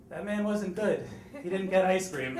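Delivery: lightly voiced small girl, matter-of-fact; little daughter. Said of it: "my daughter looks at me and says".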